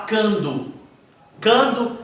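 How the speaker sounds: "cando" for quando, bare k with no U; wrong pronunciation. Shown as a demonstration. Teacher showing what one doesn't say, "cando" without U. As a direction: say "cando", the w glide dropped. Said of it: "Você não fala cando".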